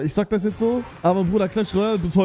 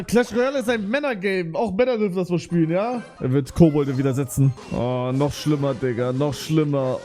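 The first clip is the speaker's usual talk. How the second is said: usual speaking voice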